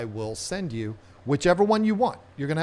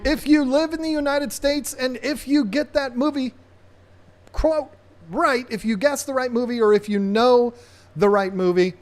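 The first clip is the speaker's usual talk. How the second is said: excited announcement; 9 semitones higher, 5 dB louder than usual. Real, not acted.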